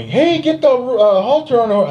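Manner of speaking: half-hearted mock shout; mock yell. Rodeo crew yelling orders at the bitten mugger. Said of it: "they're yelling at me".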